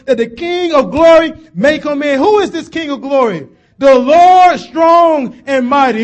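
loud, rising sermon climax, shouted call and answer; loud preacher crying out the psalm; preaching at full force. Acted. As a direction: thunder the psalm to the congregation.

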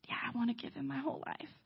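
soft, near-whispered vow; hushed commitment. Retold or imitated. Ray giving his whole life, acted in a hushed voice. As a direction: commit quietly, almost under your breath. Ray's retold solemn commitment.

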